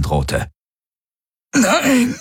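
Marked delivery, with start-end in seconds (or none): 1.5-2.1 very high, strangled refusal; choked out. A narrator's reading. Galen struggles for control of his body, choked out. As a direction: squeeze it out while being throttled. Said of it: "würgte er hervor".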